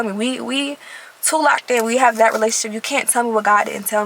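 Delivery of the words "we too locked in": ordinary talk, nothing marked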